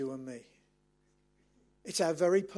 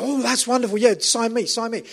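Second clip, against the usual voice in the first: high, loud gushing delight; gushing fan; 4 semitones higher, 7 dB louder than usual. Retold or imitated. Churchgoers gushing to the speaker, high and loud excited. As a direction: gush like a thrilled churchgoer.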